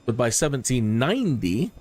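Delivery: usual speaking voice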